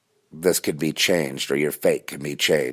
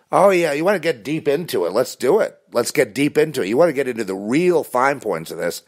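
high, keen put-on challenge; mock eagerness; 8 semitones higher, 3 dB louder than usual. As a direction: play it eager, pitched up.

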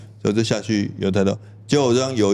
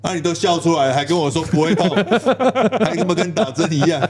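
laughing mock protest; 7 semitones higher than usual. Retelling his protest to the doctor.

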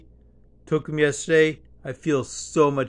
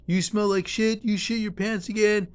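high mock yell, a bit raised; retold yelling; 8 semitones higher than usual. Cafeteria worker scolding the old woman in a high mock yell. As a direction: snap it out like the cafeteria lady scolding. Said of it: "starts yelling at her".

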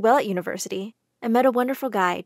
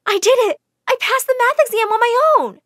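high, loud triumphant shout; excited cheer; 12 semitones higher, 7 dB louder, faster than usual. A narrator's reading. Jane telling Andrew she passed, excited shout. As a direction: burst out with joy.

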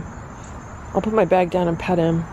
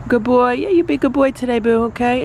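higher, louder, fast sing-song praise; 6 semitones higher, 3 dB louder than usual. Real, not acted. Saying goodbye to Boo, high quick baby talk.

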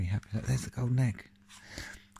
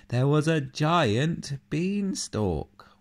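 awed reveal; 7 semitones higher, 5 dB louder, slower than usual. A narrator's reading. Revealing the beanstalk.

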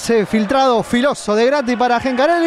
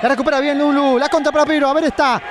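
ordinary talk, nothing marked

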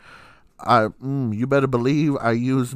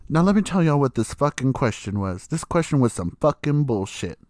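usual speaking voice

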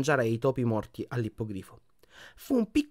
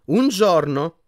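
higher and louder, fed up; exasperated; 6 semitones higher, 8 dB louder, much slower than usual. A narrator's reading. Hermione correcting Harry's fears, exasperated. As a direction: sigh it out at him. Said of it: "disse Hermione esasperata".

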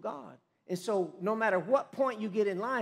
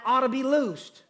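rising, louder proclamation; proclaimed; 6 semitones higher, 7 dB louder than usual. Retold or imitated. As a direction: build up and declare it. Jesus proclaims healing.

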